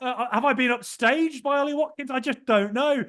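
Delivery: bubbling over with mock-confused joy; giddy disbelief. Replaying his delight at proposal.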